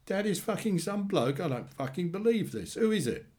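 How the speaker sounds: high-pitched, incredulous anger; acted indignation, pitched well up; outraged disbelief. A narrator's reading. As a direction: react in angry shock, then demand an answer. Brother's outraged disbelief at news.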